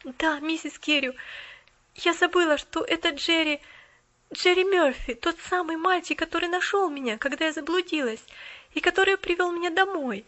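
put-on girl hurrying to explain; hurried explaining. A narrator's reading. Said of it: "заторопилась Полианна".

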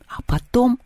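ordinary delivery

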